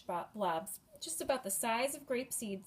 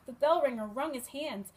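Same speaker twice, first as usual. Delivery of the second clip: ordinary delivery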